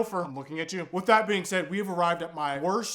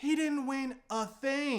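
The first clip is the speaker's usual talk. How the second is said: slow, softer, deadpan mock; mocking drawl; 4 semitones higher, 6 dB quieter, slower than usual. Real, not acted. Mocking the quarterback to viewers, slow and soft. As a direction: let it drag out in mock disbelief.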